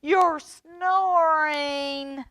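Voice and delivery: high slow whining complaint; complaining. A narrator's reading. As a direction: drag out the words high and loud. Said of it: "he called".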